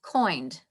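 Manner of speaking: "Coined" with an oy vowel, like coin; old vowel, kind said as "Coined"; original pronunciation. Shown as a demonstration. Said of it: "sounds like a coin".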